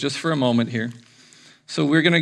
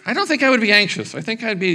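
normal talking voice

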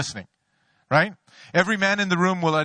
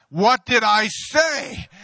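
wife's quiz; 5 semitones higher, 4 dB louder, much slower than usual. Retold or imitated. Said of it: "Then she says".

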